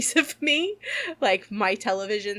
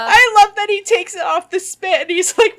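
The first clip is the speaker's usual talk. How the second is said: much higher and louder, helpless with laughter; real laughing speech; 11 semitones higher, 10 dB louder than usual. Real, not acted. Host retelling her favourite fox scene, laughing hard. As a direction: struggle to tell it while cracking up. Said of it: "always makes me laugh so hard".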